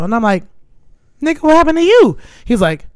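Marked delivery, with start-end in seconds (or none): 1.2-2.2 high, loud mock alarm; alarmed retold question. Storyteller replaying her reply to hospital news, mock alarm. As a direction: blurt the question in startled concern.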